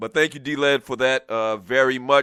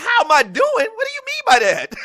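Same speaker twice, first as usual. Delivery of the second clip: high-pitched, incredulous comeback; hammed-up bewilderment; baffled mock reply; 5 dB louder than usual. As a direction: throw it back in loud, laughing disbelief. Retelling his baffled reply to friends.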